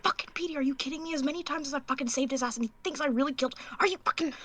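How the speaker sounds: high, hushed, rushed outburst; furious under-breath rant. Acted. Prisoner accused of killing, ranting under her breath. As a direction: go off angrily but keep the volume down. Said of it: "Under her breath, she kinda starts going off".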